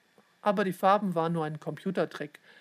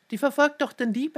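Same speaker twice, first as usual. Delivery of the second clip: high, loud, eager reply; urgent explanation; 7 semitones higher, 5 dB louder than usual. A narrator's reading. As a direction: tell it as obvious, pitched up. Leon answers worried father urgently.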